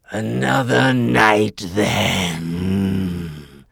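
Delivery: slow, loud, grudging tiger; Shere Khan voice. Acted. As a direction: retreat with a slow threat.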